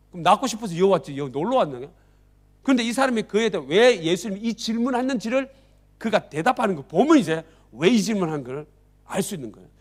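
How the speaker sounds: ordinary delivery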